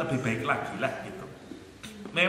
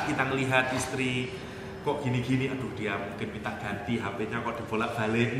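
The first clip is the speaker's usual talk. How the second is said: ordinary talk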